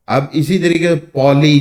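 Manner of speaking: ordinary talk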